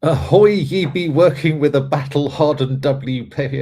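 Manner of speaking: loud, high hammed-up pirate. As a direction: read it like a swaggering buccaneer. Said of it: "Commit to the bit".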